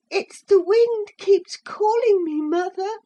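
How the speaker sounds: put-on high tearful boy voice; crying little boy. A narrator's reading. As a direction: sob it out as a scared child.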